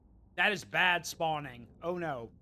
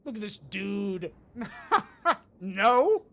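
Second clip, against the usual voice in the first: normal talking voice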